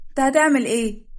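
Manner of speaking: high, loud panicky question; alarmed protest. Acted. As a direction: challenge him in comic alarm. Bride backs away on the bed. (استنكار مذعور بصوت عالٍ)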